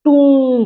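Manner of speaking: टूम said slowly and high; long oo in टूम; slow model word. Shown as a demonstration. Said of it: "इसे". (टूम धीरे ऊँचे स्वर में)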